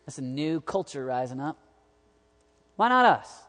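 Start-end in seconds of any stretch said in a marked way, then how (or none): 2.8-3.4 pressing the question much louder and higher; emphatic shout. Real, not acted. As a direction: hit it hard from the pulpit. Preacher repeating question louder.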